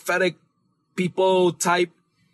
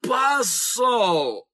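APOSTLE drawn out, higher, syllable by syllable; slow teaching demo; 5 semitones higher, much slower than usual. Shown as a demonstration. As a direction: lead a slow repeat-after-me of APOSTLE. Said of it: "Say it with me".

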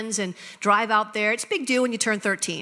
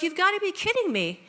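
incredulous; 8 semitones higher than usual. Retold or imitated. Stunned reply retold.

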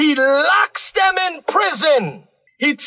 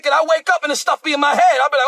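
ordinary delivery

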